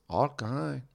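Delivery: softly imitated Mexican-accented driver; retold cabbie with a Mexican accent. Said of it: "the quintessential Mexican accent".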